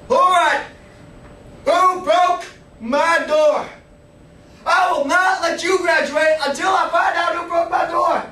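high, loud raging interrogation shouts; furious drill sergeant. Retold or imitated. Drill sergeant grills the troops about his door, high loud furious shouting. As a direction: scream at the lined-up troops. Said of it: "he'd just go crazy every day".

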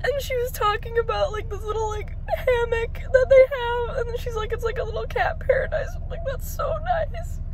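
high, crying voice, words stretched; tearful joy. Real, not acted. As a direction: choke up and squeak through happy tears. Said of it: "these are mostly tears of joy".

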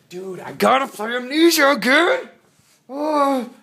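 sudden loud high burst of excitement; excited outburst. Real, not acted. Vlogger suddenly thrilled at his wall pictures, excited shout. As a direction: blurt it out, thrilled.

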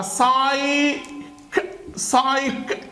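"سايك" slowly, saaay then a separate k; word built by sounds. Shown as a demonstration. Teacher joins both rules for students, "سايك" sounded out as saay-k. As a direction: stretch "سايك" and click off the final k alone. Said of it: "يبقى دي كده هتتنطق ك".

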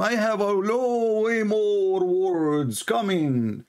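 slow, lightly voiced divine announcement; voicing God's reply. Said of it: "he just told him".

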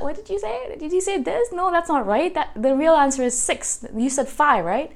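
high, puzzled questioning as a mocking imitation; lightly voiced classmate who couldn't hear her, high and doubtful; mock confused classmate. Classmate mishears her answer.

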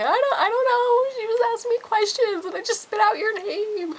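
high squeaky goofy sibling voice; mock brother. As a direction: play the clueless brother in a silly high voice. Imitating brother's excuse.

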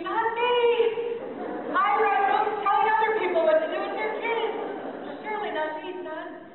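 high, loud outraged protest at the accusation; indignant disbelief. Retold or imitated. Mother protesting her son's accusation in her head, high and loud disbelief. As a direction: shoot up high and loud, stunned it could be me. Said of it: "And I'm thinking".

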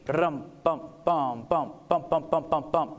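low mock drum beats, ba-dum bum bum; drum march cadence. Shown as a demonstration. Showing listeners a march cadence with vocal drum sounds. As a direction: beat out a march with your voice. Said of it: "a cadence".

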